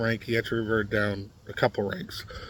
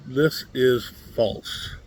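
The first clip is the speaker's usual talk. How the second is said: very slow, each word set down; measured, drawn-out flat denial; slow and deliberate; much slower than usual. Acted. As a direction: spell it out slowly, like a verdict. Flatly debunking a bio claim.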